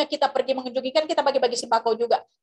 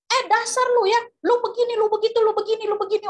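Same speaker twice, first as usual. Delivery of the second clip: angry yelling leader; 8 semitones higher than usual. Retold or imitated. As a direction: rail at him like an angry boss. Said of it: "Ketuanya itu terus teriak begini".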